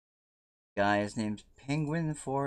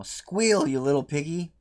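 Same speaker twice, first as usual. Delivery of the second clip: taunting; 5 semitones higher, 6 dB louder than usual. Real, not acted. Taunting the burning character.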